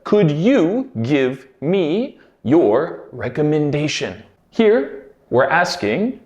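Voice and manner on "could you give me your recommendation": slow, careful dictation pace; slow clear repeat. Shown as a demonstration. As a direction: spell it out slowly for learners. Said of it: "This phrase was".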